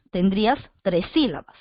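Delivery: normal talking voice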